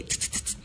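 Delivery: quick hissed electric crackles; soft, voiceless sparking noise; imitated static zap. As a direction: imitate a little static shock, tss-tss-tss. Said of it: "suena el corrientazo así de que".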